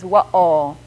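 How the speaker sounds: WA'AL with a catch in the throat mid-word; glottal stop. Shown as a demonstration. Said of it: "it's called glottal stop".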